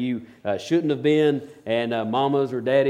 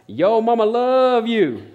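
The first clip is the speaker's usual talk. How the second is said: high, drawn-out holler; imitated yell; 9 semitones higher, 7 dB louder, much slower than usual. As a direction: mimic a woman bellowing across an auditorium, slow and stretched. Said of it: "this woman yells out".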